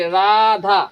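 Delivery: Radha with both vowels stretched; extra-long vowels. Shown as a demonstration. Teacher giving an example of prolonged vowels, Radha drawn out. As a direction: draw Radha out slowly, holding each आ. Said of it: "दुगुना मतलब दीर्घ समय लगता है".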